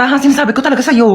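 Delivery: ordinary talk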